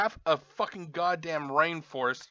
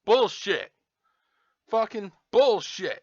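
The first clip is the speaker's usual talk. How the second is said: loud, exasperated outburst; real frustration, louder and higher; angry cursing; 4 semitones higher, 6 dB louder, slower than usual. Real, not acted. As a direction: curse it out, raised and forceful. Cursing at packaging while ranting.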